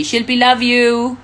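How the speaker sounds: high, bright and affectionate, Shilpi sung up; cheerful shout-out to a fan; sweet name call. Shown as a demonstration. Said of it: "please say my name".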